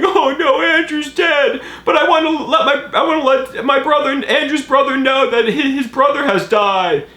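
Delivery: fake-cry the news; badly faked weeping mother; mock crying. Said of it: "while she's crying".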